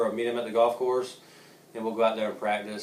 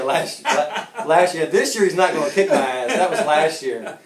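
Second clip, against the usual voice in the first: laughing through the words, a bit louder; 5 semitones higher, 7 dB louder, slower than usual. Real, not acted. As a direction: chuckle while teasing your friend. Joking about rival's good year.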